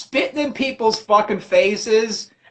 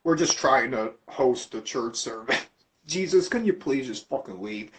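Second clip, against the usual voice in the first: much lower, soft pleading priest; timid priest; 10 semitones lower, 9 dB quieter than usual. Retold or imitated. Priest quietly begging Jesus to leave the service. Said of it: "the priest is like".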